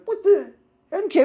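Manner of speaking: very high, loud double take; hammed-up squeal of confusion; mock shock. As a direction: blurt it out high in comic disbelief. Retold shock at friend vanishing.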